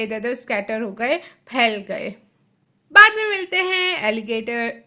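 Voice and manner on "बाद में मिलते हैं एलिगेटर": loud, higher taunting yell; cheeky shout. A narrator's reading. Boy shouting goodbye as he pulls the switch, loud. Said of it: "चिल्लाया mic TV ने".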